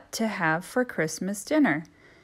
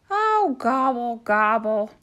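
louder dismayed gobble; gobbling turkey voice; 4 semitones higher, 6 dB louder than usual. Acted. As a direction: gobble in frustration.

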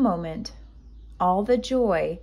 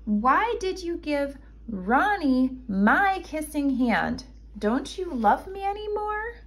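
hurt little raccoon; 8 semitones higher than usual. A narrator's reading. Child hurt, jealous of baby brother.

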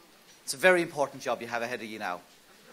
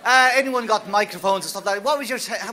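calling loudly over a noisy room, much higher; 6 semitones higher, 10 dB louder than usual. Real, not acted. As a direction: rein in the room after the exercise, loud and high. Calling the room back after exercise.

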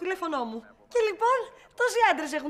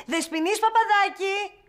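slow, higher mock summons; imitated shout; 4 semitones higher, 4 dB louder, slower than usual. Retracing her steps, quoting the boss, imitated shout. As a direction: mimic the boss calling out, drawn out. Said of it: "Μετά εσύ φώναξες".

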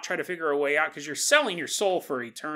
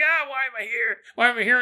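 mock panicked damned; 7 semitones higher, 5 dB louder, faster than usual. Damned people in hell.

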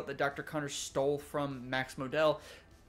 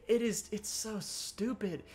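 slow, high disbelief, drawn out; exasperated; 6 semitones higher, slower than usual. Real, not acted. Reviewer venting to viewers about the villain, exasperated. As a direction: let the absurdity drag out of you.

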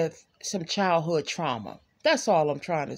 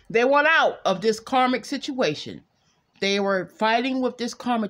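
ordinary talk, nothing marked

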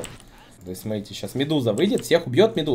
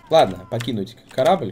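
ordinary talk

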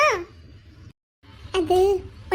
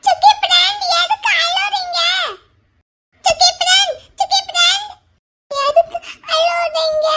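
put-on much higher, louder child's voice, half laughing half crying; crying child shouting; 7 semitones higher, 4 dB louder than usual. A little boy cries out asking why his friend is crying, high and tearful. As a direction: call out through tears, high and loud.

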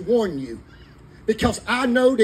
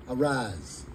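quiet command; 9 semitones lower, 7 dB quieter than usual. Acted. God's call to men.

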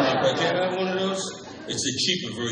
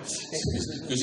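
ordinary talk, nothing marked

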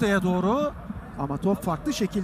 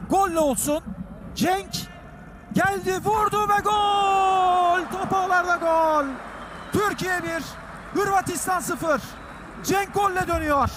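screaming football commentator; 8 semitones higher than usual. Real, not acted. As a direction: roar the goal with everything. Goal call, screaming commentary.

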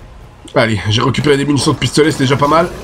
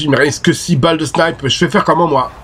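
ordinary talk, nothing marked

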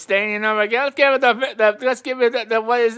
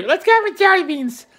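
squeaky contestant voice; 8 semitones higher than usual. Pretend. Contestant picks next item.

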